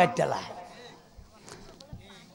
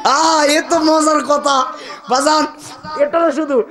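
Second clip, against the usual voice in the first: laughing; 9 semitones higher, slower than usual. Real, not acted. Laughing at own joke.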